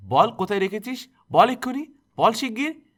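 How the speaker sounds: high, loud, frenzied shouting; screaming demand. A narrator's reading. Aunt beating Durga's head, screaming for the box. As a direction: yell each order while striking.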